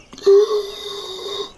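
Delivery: long high gasp of realization; drawn-out horrified intake; shocked gasp. Shown as a demonstration. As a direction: gasp as if the twist just hit you. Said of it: "look in the mirror and go like".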